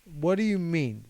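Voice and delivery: mad. Retold or imitated. Upset grandma reacting to the accident news, mad. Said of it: "She's mad".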